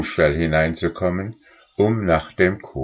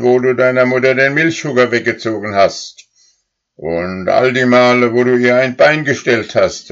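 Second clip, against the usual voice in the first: higher and louder furious reckoning; furious old cow; 6 semitones higher, 6 dB louder than usual. A narrator's reading. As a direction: list every wrong he did. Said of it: "die allerzornigste".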